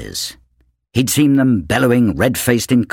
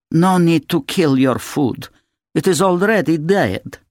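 a cool, scornful lady, pitched higher; distant and contemptuous; 6 semitones higher than usual. A narrator's reading. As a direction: talk down to the children coldly. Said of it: "distant and contemptuous".

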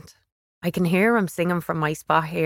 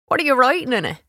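higher, smirking big-brother question; 7 semitones higher, 4 dB louder, faster than usual. A narrator's reading. Big brother sneers at his sister's writing, smirking. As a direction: ask it with a mocking smirk. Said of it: "in a smirking sort of voice".

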